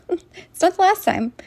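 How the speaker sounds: a defiant laugh through the words; scoffing laugh. Real, not acted. Mocking the animals who attack them, laughing defiantly.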